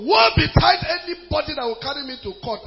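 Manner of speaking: loud, emphatic warning; preacher's defiant outburst. Real, not acted. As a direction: throw down a challenge to the congregation. Defiant warning shouted to congregation.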